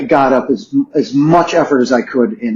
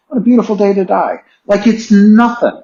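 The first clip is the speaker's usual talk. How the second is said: higher, bright wonder; joyful; 9 semitones higher than usual. Retold or imitated. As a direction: marvel at it. Serene joy at death.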